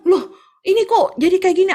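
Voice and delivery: high, loud retold surprise; startled disbelief. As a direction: blurt it out, taken aback. Retold startled reaction to message.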